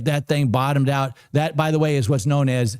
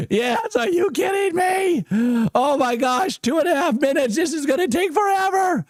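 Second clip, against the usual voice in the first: real excited shouting; 13 semitones higher than usual. Real, not acted. Trader watching his price jump, shouting with joy. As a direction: yell at the screen as the trade wins. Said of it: "If you guys are excited as me".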